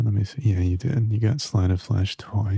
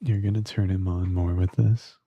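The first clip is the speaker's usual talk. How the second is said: ordinary talk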